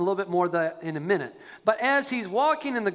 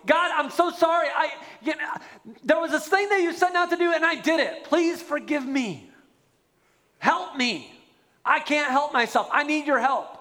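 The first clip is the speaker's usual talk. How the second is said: pleading confession; 7 semitones higher than usual. Retold or imitated. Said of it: "and going".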